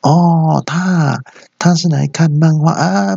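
high, drawn-out phony client; put-on, stretched high voice; fake client voice. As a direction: disguise your voice as a client greeting a manager. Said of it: "还扮演客户".

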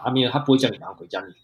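ordinary talk

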